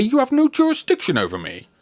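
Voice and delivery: high, fast outburst; panicked protest. Acted. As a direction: blurt it out in alarm. Scrooge panics at police.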